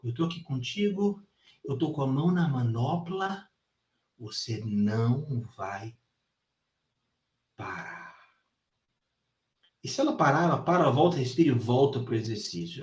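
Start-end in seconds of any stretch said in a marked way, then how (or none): 0.0-8.2 soft, hushed reassurance; calm coaching. Retold or imitated. Trainer soothes his student at the bar, much softer. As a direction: talk her down quietly through the pain. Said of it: "Eu falo".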